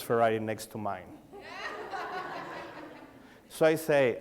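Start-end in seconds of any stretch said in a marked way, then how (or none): 1.1-2.9 a high, soft burst of laughter. Real, not acted. To the audience, realizing the Ferrari wasn't his car, laughing high. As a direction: laugh at your own embarrassing mix-up.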